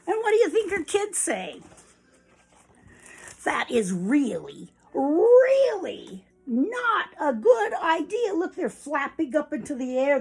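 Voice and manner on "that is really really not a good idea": second really leaps high and loud; upset warning. Acted. Chicks trying to warn, upset and drawn out. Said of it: "They're so upset, trying to warn".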